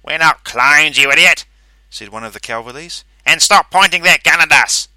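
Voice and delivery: loud, high, angry retort. A narrator's reading. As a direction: snap back at him angrily. Angry clone snaps at narrator.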